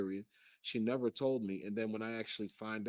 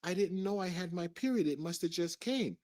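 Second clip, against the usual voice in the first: imitating her in a high-pitched voice; higher mock-innocent woman's voice; feigned innocence; 7 semitones higher than usual. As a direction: pretend to be surprised, pitched well up. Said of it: "she pretends like".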